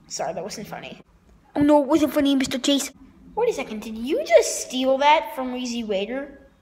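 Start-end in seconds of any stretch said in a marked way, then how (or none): none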